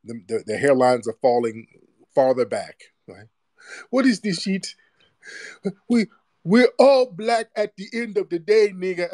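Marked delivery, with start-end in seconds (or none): 3.9-9.1 high-pitched put-on protest; mock indignant critic. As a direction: plead in a high, mocking voice. Mocking critics' unity plea.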